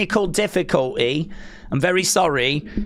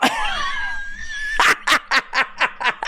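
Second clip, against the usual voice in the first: high shrieking laugh. Real, not acted. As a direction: burst out in a shrill cackle. Laughs after timing viewer out.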